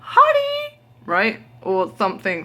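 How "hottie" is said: "Hottie" squeaked way up high like a flirty call; squeaky high hottie. Retold or imitated. Calling out to a stranger she mistook for her man, squeaked high. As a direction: pitch "Hottie" right up into a silly squeal. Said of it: "but, like".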